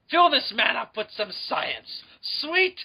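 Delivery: slow, savoring, rising on sweet; hammed-up relish. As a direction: drool over the words like a treat. Hamming up "sweet science.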